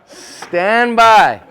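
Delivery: loud, long drawn-out call; stretched, booming range command; range officer command. Acted. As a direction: bellow it across the firing range. Imitated shooting range call.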